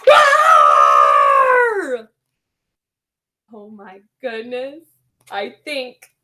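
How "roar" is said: loud shouted roar. Pretend. Roaring to scare the hiccuping penguin. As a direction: let out a big monster roar. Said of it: "Shout. Go crazy".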